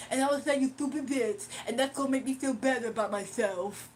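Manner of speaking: put-on voice of a self-satisfied heckler; mocking caller impression. Streamer voices the caller planning his next attack, mocking. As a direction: act out his scheming, mockingly. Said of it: "This is what he thought the whole time".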